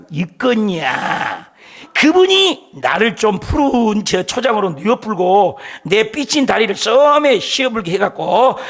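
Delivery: preacher showing off his Jeolla dialect to the congregation. Pretend. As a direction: lay on the Jeolla dialect with relish. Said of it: "전라도는 내가 좀 자신 있어".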